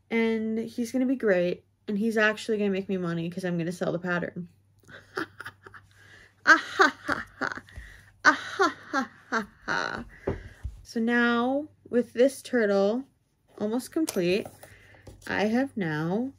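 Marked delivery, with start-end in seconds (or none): 6.5-10.0 high hammed-up ha-ha laugh; mock villain laugh. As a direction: laugh like a cartoon schemer. Staged laugh after money joke.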